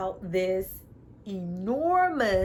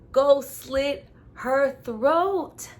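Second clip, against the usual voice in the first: slow, louder hammed-up threat; mock menace; 5 semitones higher, 5 dB louder, much slower than usual. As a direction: drag it out like a villain. Mock villain line.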